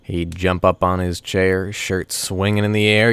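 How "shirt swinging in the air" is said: usual speaking voice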